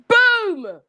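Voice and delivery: a high, loud burst of triumph; punchy celebratory boom; excited shout. Real, not acted. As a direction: shout it out like you just cracked it. Excited revelation exclamation.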